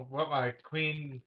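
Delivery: ordinary talk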